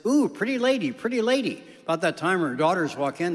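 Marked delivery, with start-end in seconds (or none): 0.1-1.6 high-pitched parrot squawking compliments; high parrot voice, swooping down on each phrase; talking parrot. Acted. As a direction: coo it up high like a pet bird. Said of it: "The parrot says".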